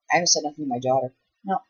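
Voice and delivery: ordinary talk, nothing marked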